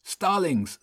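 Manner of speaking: high, restrained shout. A narrator's reading. Boy announces the birds arriving, mild shout. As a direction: call out on a high pitch, without much volume. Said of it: "Spencer shouted".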